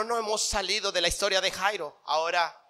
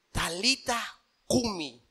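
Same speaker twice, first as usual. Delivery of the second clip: "Talita cumi" drawn out slowly and quietly; "Talita cumi" dicho lento y suave; slow, soft; 5 dB quieter, much slower than usual. Acted. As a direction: lay each word down gently. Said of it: "palabras en arameo".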